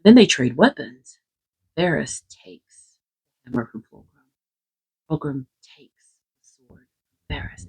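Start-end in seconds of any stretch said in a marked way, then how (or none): 1.8-7.7 soft, reverent whisper; quiet storyteller whisper over the swap. Acted. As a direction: hush it like a sacred exchange. Hushed telling of weapon exchange.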